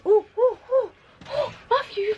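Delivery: sharp high yelps of pain; startled yelps. Real, not acted. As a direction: cry out, suddenly burnt. Trousers burnt by chain, yelps.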